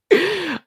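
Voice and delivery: real burst of giggling; giggle. Real, not acted. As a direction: laugh at yourself. Laughing at own silly honks.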